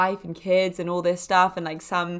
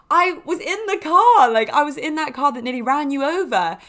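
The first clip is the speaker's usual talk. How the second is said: high, loud outburst, easing slightly; incredulous exclamation; 6 semitones higher, 6 dB louder than usual. Retold or imitated. Telling her friend she was in that car, high incredulous outburst. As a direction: blurt it out high in disbelief.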